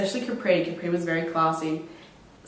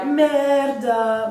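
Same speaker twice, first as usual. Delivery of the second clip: Roman jeer; 5 semitones higher than usual. Retold or imitated. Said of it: "all the Romans would be like".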